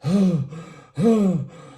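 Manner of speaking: loud heavy exhale shown as a demo; heavy panting breath. Shown as a demonstration. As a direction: act out the panicked breathing. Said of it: "I'm breathing like, like that".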